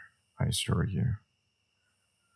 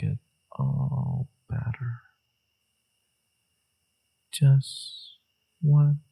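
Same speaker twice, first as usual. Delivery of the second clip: pleading seducer; 3 semitones higher than usual. Acted. Injured lover begging companion for a soothing kiss, pleading seductively.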